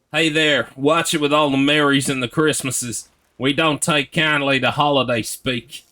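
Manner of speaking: put-on southern accent. Streamer scolding chat over holiday words, in a hammed-up southern accent. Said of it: "that's some good southern accent".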